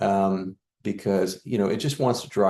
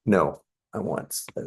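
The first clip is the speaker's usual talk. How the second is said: normal talking voice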